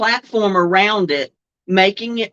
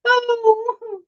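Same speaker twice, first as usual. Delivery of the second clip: high squeaky gasp of laughter; a real helpless crying laugh; 14 semitones higher than usual. Real, not acted. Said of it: "I don't think I'm gonna survive trivia".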